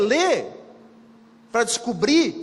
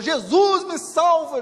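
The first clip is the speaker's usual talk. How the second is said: louder, higher cry for Jesus; cry for help; 7 semitones higher, 6 dB louder, slower than usual. Retold or imitated. Drowning Teresa of Ávila calls out to Jesus, a hammed-up cry. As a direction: call out as if drowning.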